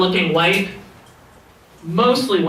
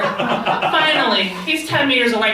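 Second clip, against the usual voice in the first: ordinary talk, nothing marked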